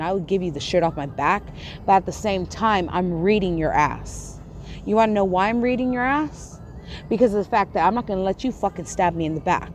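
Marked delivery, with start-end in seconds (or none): none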